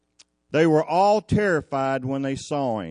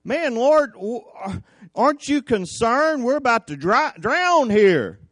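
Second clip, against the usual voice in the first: high, raised-voice cry for help; urgent plea; 7 semitones higher, 5 dB louder than usual. Acted. Disciples waking Jesus in a sinking boat, urgent plea. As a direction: wake him with an alarmed, acted plea. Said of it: "had to wake Jesus up and go".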